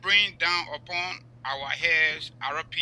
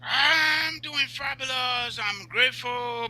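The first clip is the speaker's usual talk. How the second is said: higher and slow, drawn out with relish; showy greeting; 5 semitones higher, slower than usual. Real, not acted. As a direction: stretch it like a proud showman. Host greets guest on show.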